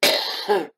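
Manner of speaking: sharp loud cough. Real, not acted. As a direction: cough hard, choking a little. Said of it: "just got a bone in my throat".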